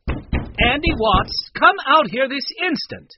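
loud, high stern order; demanding. A narrator's reading. Woman pounding on the cabin door, demanding. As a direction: order him out at once. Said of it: "she demanded".

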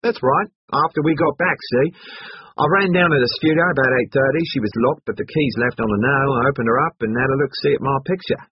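high, loud and eager; lively character voice, remembering aloud; Hatchet, brash student. Acted. Hatchet recounts his visit.

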